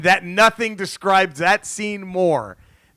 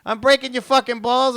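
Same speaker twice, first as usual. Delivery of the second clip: imitating a mobster; 8 semitones higher than usual. Voicing Pesci in retold scene.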